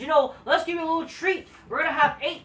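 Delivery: a lightly hammed-up GM announcement; mocking the GM. Viewers hear the GM's treat, lightly mocked as a bored announcer. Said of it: "and says".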